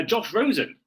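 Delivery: normal talking voice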